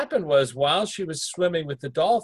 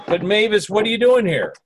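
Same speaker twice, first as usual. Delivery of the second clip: startled, high and louder; surprised; 6 semitones higher, 5 dB louder than usual. Real, not acted. Host to unexpected spirit, raised surprised call. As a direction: caught off guard by a guest. Addressing spirit who barged in.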